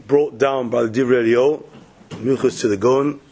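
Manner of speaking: ordinary delivery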